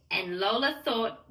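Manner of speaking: ordinary delivery, nothing marked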